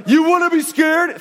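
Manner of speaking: mock taunting yell. Pastor pretending to taunt his frightened sons, a mock loud jeer. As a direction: taunt them like a bully dad, clearly joking. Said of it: "I wasn't mean to my kids".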